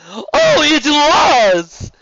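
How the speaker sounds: over-the-top friendly serpent duke; flamboyant sea serpent. Acted. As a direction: gush like a warm, showy nobleman. Serpent insists.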